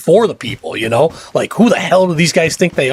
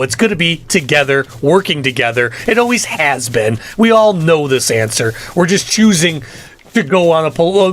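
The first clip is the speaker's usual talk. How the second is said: normal talking voice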